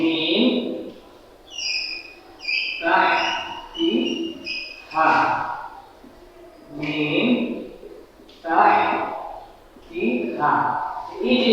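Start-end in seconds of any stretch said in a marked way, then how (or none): 0.0-5.1 soft, very slow "মিন তাহতিহা" with the ন hummed through the nose; softly recited "মিন তাহতিহা" with a held nasal ন; nasal ghunna recitation. Shown as a demonstration. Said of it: "নাকের বাসি থেকে গুণনা করে গোপন করে করতে হবে". 6.8-10.5 soft, very slow "মিন তাহতিহা" with the ন hummed through the nose; softly recited "মিন তাহতিহা" with a held nasal ন; nasal ghunna recitation. Shown as a demonstration. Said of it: "নাকের বাসি থেকে গুণনা করে গোপন করে করতে হবে".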